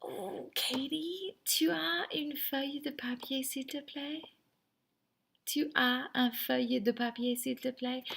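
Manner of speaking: put-on high voice, drawn-out "Oh" before the ask; student asking friend. Student asking a friend to borrow paper, high wheedling request. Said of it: "ask to borrow a piece of paper from a friend".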